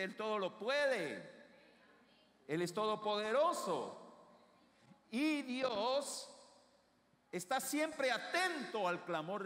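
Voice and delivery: building to loud, high proclamation; slow, forceful declarations, stretching the key words; fervent preaching. Real, not acted. Preaches God's power with rising force.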